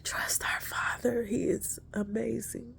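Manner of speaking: reverent hushed whisper. Real, not acted. Softer whispered praise.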